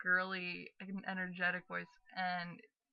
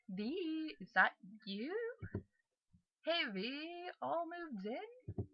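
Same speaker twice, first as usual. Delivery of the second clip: friendly brother; 9 semitones higher, much slower than usual. Acted. Brother greeting his sister on moving in, high friendly lilt. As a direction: lift the pitch and greet her gently. Voices William greeting Virginia.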